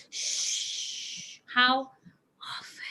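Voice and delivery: slow whispered often. Shown as a demonstration. Teacher modelling quiet slow reading, whispered. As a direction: hush them and whisper the word slowly. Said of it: "We are reading slowly".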